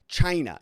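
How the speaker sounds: punched "China", high and loud, dropping fast; Donald Trump. Retold or imitated. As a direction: imitate a hard-hit "China". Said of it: "he says it like that".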